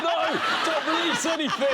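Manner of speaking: very high, loud mock panic; panicking tablet. Guest voicing the tablet in the drink, high mock panic. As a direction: squeak a hammed-up refusal as a tiny tablet. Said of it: "It's like that".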